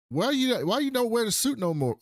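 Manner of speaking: high, lightly voiced child asking; little kid. Fan kid asking the hero, high childlike voice. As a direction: put on a small kid's high question. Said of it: "One little kid was like".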